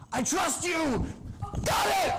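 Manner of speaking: high, loud thrilled yell; excited shout. Retold or imitated. Jonas yelling to Paul before riding the anaconda, high and loud. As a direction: shout it out as you leap. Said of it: "he goes".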